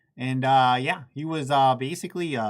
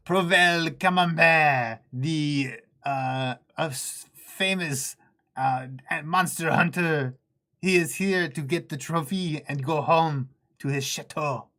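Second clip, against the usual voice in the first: slow, loud hammed-up French accent; 5 semitones higher, 7 dB louder, slower than usual. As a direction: introduce the French monster hunter with a hammed-up French accent. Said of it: "My French accent".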